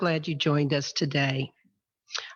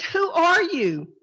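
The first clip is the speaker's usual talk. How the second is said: high, drawn-out question; acted high-pitched call; 11 semitones higher, 3 dB louder, slower than usual. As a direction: call the question across a distance, slowly. Calling back to unseen passer-by.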